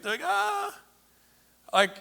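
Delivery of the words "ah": high puzzled ah. Acted. Disciples feign understanding.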